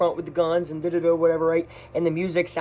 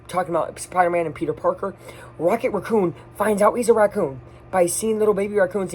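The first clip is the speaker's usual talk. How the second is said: ordinary talk, nothing marked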